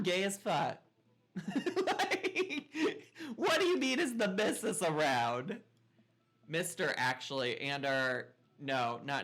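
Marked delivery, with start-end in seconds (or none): 1.4-5.6 high laughing, louder; laughing incredulous. Real, not acted. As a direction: laugh it off in disbelief. Neighbor asks about the missus.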